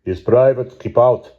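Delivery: put-on heavy Russian accent, loud and curt. A narrator's reading. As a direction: bark it in thick Russian English. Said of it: "he spoke in a heavy Russian accent".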